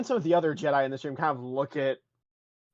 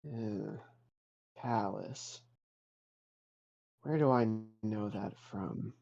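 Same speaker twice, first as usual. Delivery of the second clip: put-on puzzled old master; 3 semitones lower, 8 dB quieter, slower than usual. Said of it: "looks you up and down and goes".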